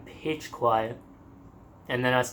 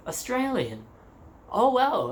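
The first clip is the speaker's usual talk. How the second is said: impressed surprise; 9 semitones higher, slower than usual. Retold or imitated. Local man amazed to meet an Australian, high surprised wow. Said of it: "he's so impressed".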